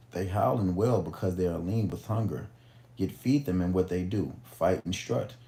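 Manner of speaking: deliberate, projected book reading; storyteller reading aloud to listeners; story narration. A narrator's reading. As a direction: read it out like an old parable, stressing key words.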